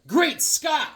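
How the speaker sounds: high heroic exclamation; comic hero exclaiming. Acted. Reading a comic panel aloud, heroic high exclamation. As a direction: shout it like a square-jawed hero. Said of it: "He goes".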